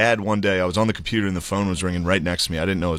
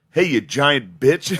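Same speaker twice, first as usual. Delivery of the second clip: higher, slow, drawn-out insult; imitated booming heckle, much louder than the lead-in; retold blunt call-out; 4 semitones higher, 3 dB louder, slower than usual. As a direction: call it out slowly at a stranger.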